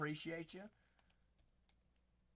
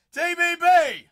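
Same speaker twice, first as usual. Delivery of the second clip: high, loud stretched shout of the name; shouted callout; 13 semitones higher, 17 dB louder than usual. Acted. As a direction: yell it across the room. Calling out to a viewer.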